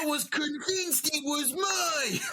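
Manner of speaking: milk a contestant's tears; hammed-up sob, slowed; mock teary wail. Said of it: "mocking Blake after S0".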